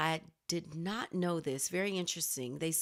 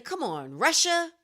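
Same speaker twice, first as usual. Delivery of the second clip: loud, higher exasperated plea; exasperated call-out; 8 semitones higher, 6 dB louder than usual. Acted. Host scolding Russia over the ad, exasperated loud call. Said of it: "It makes me say".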